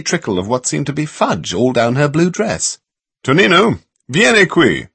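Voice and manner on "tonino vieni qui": calling out. A narrator's reading. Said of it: "somebody called".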